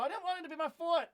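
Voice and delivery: put-on high, whiny, childish whine; whiny complaint. A kid whining about his own foot in a high whine. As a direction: whine it like a kid denied a toy.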